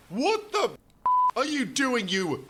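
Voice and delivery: high exasperated outburst; hammed-up angry driver; exclaimed. Driver yelling at stubborn Henry, mock exasperated. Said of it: "his driver exclaimed".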